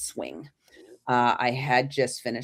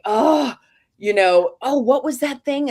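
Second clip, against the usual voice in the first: higher, louder eager questions; eager excitement; 9 semitones higher, 6 dB louder than usual. Retold or imitated. Daughter excitedly telling her mom about the sketch, eager and loud. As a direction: burst out as the memory clicks.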